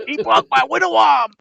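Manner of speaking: very high, loud boxer voice; Mike Tyson voice. Retold or imitated. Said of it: "like Mike Tyson".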